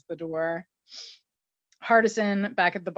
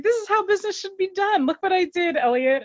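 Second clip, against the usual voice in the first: higher put-on voice, gloating; smug mock Hardison; 12 semitones higher than usual. Hardison bragging to Elliot after the deal, high gloating voice. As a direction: show off the deal like a proud kid.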